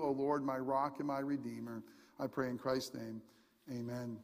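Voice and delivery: soft, hushed opening prayer. Acted. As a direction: pray quietly and reverently to God. Opening prayer before sermon.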